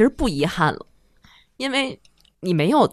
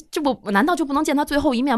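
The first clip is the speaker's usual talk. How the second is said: retold indignant protest; 7 semitones higher, faster than usual. Recalling being kept from her dying grandmother, rushed indignant protest. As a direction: pitch rising higher, words rushing faster, disbelief building.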